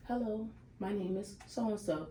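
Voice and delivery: soft, put-on professional introduction; code-switched greeting. New Yorker hiding her accent at an interview, code-switched voice. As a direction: drop the volume and introduce yourself formally. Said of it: "Why I gotta code switch?".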